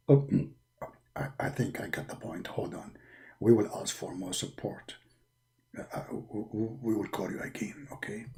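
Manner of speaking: very low, hushed angel voice; Jibreel's voice. Acted. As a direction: speak deep and hushed.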